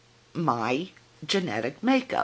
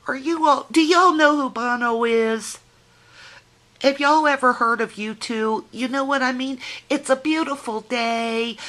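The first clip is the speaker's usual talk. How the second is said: excited; 8 semitones higher, 5 dB louder than usual. Real, not acted. Gushing to viewers about U2.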